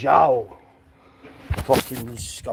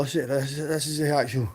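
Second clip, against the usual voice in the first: ordinary delivery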